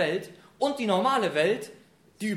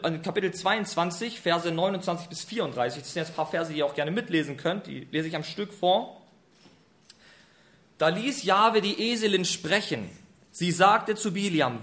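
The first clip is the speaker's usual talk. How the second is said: usual speaking voice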